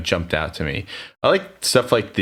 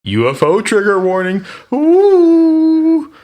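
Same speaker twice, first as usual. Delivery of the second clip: high, loud ghostly wail into a long "Ooh"; mock-spooky announcement; 8 dB louder, much slower than usual. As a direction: ham up an eerie warning, rising into a drawn-out wail. Mock spooky warning about UFO case.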